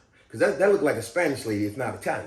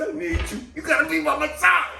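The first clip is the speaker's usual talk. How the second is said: retold yelling; 11 semitones higher than usual. Patient begs the nurse to stay by his side, high mock yell. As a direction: imitate a pleading holler, pitched way up. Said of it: "I started yelling at the sister".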